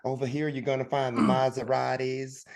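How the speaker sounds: high, slow Southern sales voice; Southern shopkeeper. Retold or imitated. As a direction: mimic an Atlanta shop clerk with a Southern drawl. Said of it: "you hear people like".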